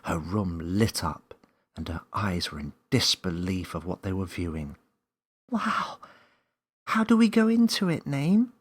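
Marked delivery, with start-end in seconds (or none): 5.5-8.5 put-on much higher, louder child's voice; young Millie eagerly asking the creature; eager little girl. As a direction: bounce with curiosity like a young child.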